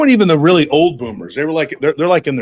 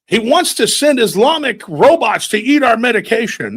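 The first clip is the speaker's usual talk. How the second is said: ranting boomer; 6 semitones higher than usual. Retold or imitated. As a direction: rant like an outraged old Facebook poster. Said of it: "typing up everything".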